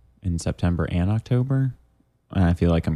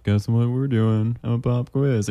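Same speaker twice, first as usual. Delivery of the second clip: teacher voice; 5 semitones higher, much slower than usual. Retold or imitated. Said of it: "in school when they'd be like".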